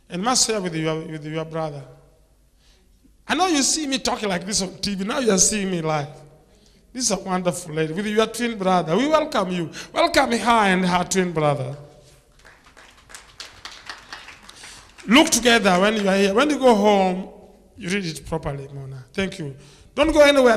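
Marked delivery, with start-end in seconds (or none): 3.3-17.3 loud, fast, fervent welcome from the pulpit; preacher welcoming a mother and her twins, loud and fervent; full-force preaching. Real, not acted. Preacher greets congregation at full force. 19.9-20.6 1 loud call-out. Real, not acted. Preacher calls out to Mona.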